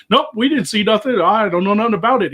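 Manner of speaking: high, insistent imitated denial; mock innocent denial. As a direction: play caught-out guys covering up. Acting out men's false denial.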